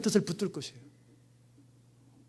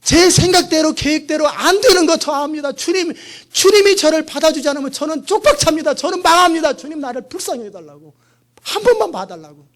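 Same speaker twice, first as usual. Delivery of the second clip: desperate plea; 6 semitones higher, 5 dB louder than usual. Retold or imitated. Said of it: "제가 하나님 앞에 그랬어요".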